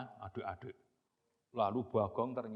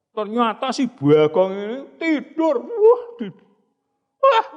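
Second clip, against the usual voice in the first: excited narration; 10 semitones higher, 17 dB louder than usual. A narrator's reading. Narrating excitedly at high pitch.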